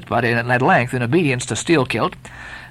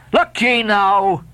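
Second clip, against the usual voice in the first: crying out; 7 semitones higher, much slower than usual. Acted. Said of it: "cried the Lakeman".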